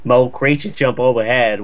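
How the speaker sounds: ordinary talk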